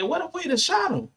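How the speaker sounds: high, stunned voice of the cop; high mock cop voice, disbelieving; imitating the cop. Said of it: "Cops say".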